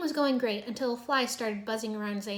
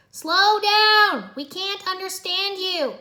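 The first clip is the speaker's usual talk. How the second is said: yelling; 9 semitones higher, 10 dB louder, much slower than usual. A narrator's reading. Teammate yelling at garbled Zane.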